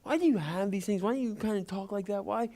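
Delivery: high, prying schoolkid voice; curious kid demanding answers; taunting kid. Retold or imitated. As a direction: needle him like a nosy classmate. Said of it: "a kid came up and was like".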